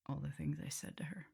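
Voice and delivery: ordinary talk, nothing marked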